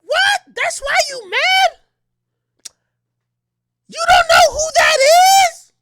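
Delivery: put-on very high, loud incredulous girly voice; mimicking girlfriend. Narrator imitating his date shocked he is mad. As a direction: squeal in disbelief like her. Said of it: "She said".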